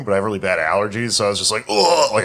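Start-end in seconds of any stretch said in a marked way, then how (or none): 1.7-2.1 high, loud choking noise; mock choking. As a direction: imitate an allergy choke, high and loud. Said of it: "you start choking".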